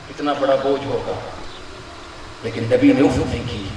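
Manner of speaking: grieving hush. Real, not acted. Preacher mourning the Prophet's suffering, low and hushed. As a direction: let the grief drop the voice low.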